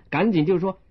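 ordinary delivery, nothing marked

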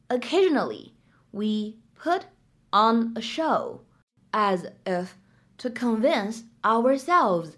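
slow, chunked read with pauses; slow phrase by phrase. Shown as a demonstration. Teacher slows the line down phrase by phrase for students. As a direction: break it into phrases for learners. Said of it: "我们需要注意哪些发音技巧".

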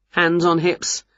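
plain reading voice

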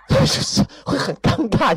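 slow words broken by a laugh; laughing awkwardly. Real, not acted. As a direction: laugh it off, embarrassed. (尴尬地笑着慢慢说)

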